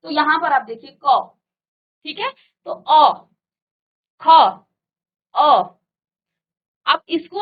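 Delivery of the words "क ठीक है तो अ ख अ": teacher isolating अ in क and ख, long pauses between; consonant with inherent अ. Shown as a demonstration. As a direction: sound out क, ख and अ separately, loud, with the open अ vowel. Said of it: "आप अ का उच्चारण करेंगे इसके साथ".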